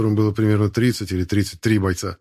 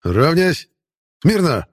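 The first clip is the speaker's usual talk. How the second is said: barked drill commands, higher and louder; shouting drill sergeant; 7 semitones higher, 4 dB louder, much slower than usual. A narrator's reading. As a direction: bark parade commands at the squad. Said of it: "Могила скомандовал".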